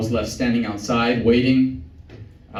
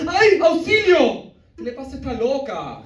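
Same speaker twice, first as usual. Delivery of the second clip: startled guy; 12 semitones higher than usual. Retold or imitated. Man knocked into by the girlfriend, crying out high in Spanish. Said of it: "I heard the guy go".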